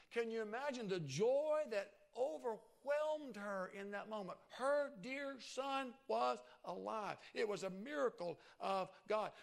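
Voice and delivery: exultant preacher voice; joyful preaching. Real, not acted. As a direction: let the joy of the miracle ring.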